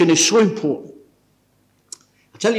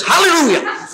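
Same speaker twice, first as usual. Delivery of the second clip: sister's hallelujah; 11 semitones higher, 6 dB louder than usual. Retold or imitated. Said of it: "gave him a kick up the bottom and says".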